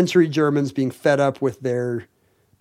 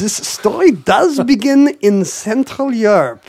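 hammed-up German accent, higher pitched; mock German accent; 6 semitones higher, 3 dB louder, slower than usual. Podcast hosts clowning about nudist history, mock German accent. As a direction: put on a cartoonish German naturist's accent. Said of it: "saying all this in a German accent".

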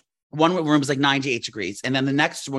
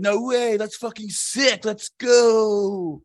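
high, slow-stretched bro cheer; put-on bro accent; 6 semitones higher, much slower than usual. Locker-room guys react to his record, hyped bro accent. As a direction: hype it up like an awed gym bro. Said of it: "what is that accent".

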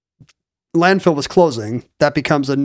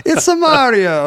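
high, drawn-out cartoon Italian accent; terrible Italian accent; 8 semitones higher, 3 dB louder than usual. Shown as a demonstration. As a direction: ham up a silly Italian accent. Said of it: "whereas yours is terrible".

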